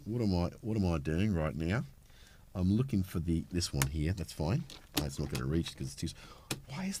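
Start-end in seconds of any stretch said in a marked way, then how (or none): none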